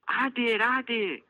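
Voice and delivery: put-on high, slow eager little girl cry; excited child shout. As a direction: call out with joy. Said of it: "shouts Elise".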